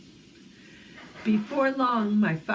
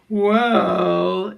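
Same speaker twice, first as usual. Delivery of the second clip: big loud gorilla bellow, held long; huge voice; 9 dB louder than usual. A narrator's reading. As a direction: boom it out hugely. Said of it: "in a huge voice".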